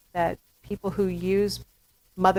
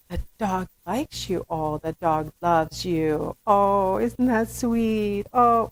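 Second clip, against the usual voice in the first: put-on baby talk. Shown as a demonstration. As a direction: coo over the dog to the baby. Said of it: "I'd say".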